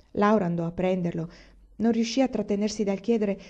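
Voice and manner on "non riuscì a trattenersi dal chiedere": ordinary delivery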